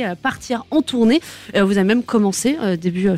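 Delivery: ordinary delivery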